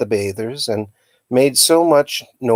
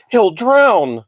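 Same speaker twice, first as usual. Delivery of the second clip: much higher, louder panicked cry; desperate cry; 11 semitones higher, 5 dB louder than usual. A narrator's reading. Eleanor fears her uncle will drown, panicked cry. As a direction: shout it in terror for him. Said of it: "cried Eleanor desperately".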